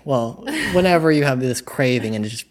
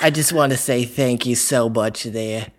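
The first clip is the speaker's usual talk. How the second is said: hammed-up attempt at a British accent; Herschel's British accent. Shown as a demonstration. Host tries British speech for laughing friends, British accent. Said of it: "It sounds like Herschel doing a British accent".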